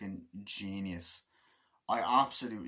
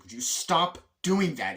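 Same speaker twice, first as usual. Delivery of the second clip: loud, higher acted complaint; raised, exasperated demand; annoyed; 5 semitones higher, 7 dB louder than usual. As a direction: tell them off in mock irritation. Acts annoyed driver snapping.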